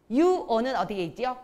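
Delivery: usual speaking voice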